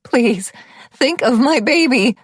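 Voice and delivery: high, loud, slow desperate plea; desperate pleading. A narrator's reading. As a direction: beg for the baby's life. Begging for the baby.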